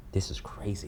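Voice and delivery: soft, barely voiced disbelief; choked-up near-whisper; overcome. Real, not acted. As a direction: let it out under the breath, moved. Overcome talking about his children.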